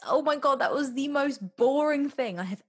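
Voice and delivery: loud, high outraged disbelief; can't believe what they heard; shocked disbelief. Real, not acted. Disbelief at friend's opinion.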